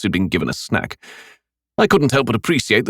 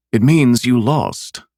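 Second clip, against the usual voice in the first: slow, calm, patient; 4 semitones higher, slower than usual. A narrator's reading. Killer telling the maimed boy he lost, patiently. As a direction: explain it gently and slowly. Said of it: "I said to him patiently".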